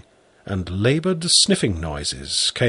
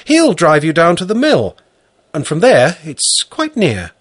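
higher, louder woman's voice; 7 semitones higher, 6 dB louder than usual. Acted. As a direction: speak as the farm wife. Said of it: "said Valentina".

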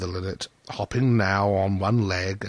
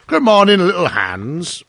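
loud, high opening greeting, slowing on the name; hearty miller; 8 semitones higher, 8 dB louder than usual. A narrator's reading. Miller greeting little Hans in his garden, hearty. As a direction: hail him heartily as the miller. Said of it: "said the miller".

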